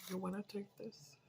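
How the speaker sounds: soft discreet question; hushed offer of food; quiet offer. Retold or imitated. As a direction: ask it softly, kindly.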